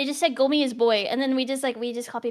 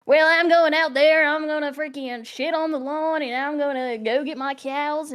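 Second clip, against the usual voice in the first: loud, slow, uncute country drawl; country Southern accent; 3 semitones higher, 4 dB louder, slower than usual. Acted. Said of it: "But instead, like, I'm like".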